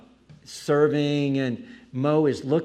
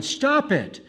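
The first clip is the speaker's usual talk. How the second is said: high-pitched imitation of protesting sons; mock indignant cry; retold protest; 11 semitones higher than usual. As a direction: act out a pleading objection, pitched right up. Sons protesting at their father.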